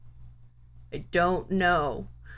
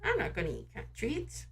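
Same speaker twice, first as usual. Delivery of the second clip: much softer, under the breath; quiet repeat; 3 semitones higher, 8 dB quieter than usual. Shown as a demonstration. Streamer repeats the cat treat line much softer to herself. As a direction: murmur it to yourself. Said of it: "repeated softer".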